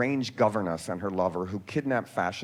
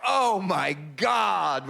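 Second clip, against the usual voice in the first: slow, drawn-out gasp of shock; shocked neighbors; 5 semitones higher, 4 dB louder, much slower than usual. Retold or imitated. Neighbors see the film shoot, slow shocked gasp. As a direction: stretch it out like horrified neighbours. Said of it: "the neighbors would come out and go".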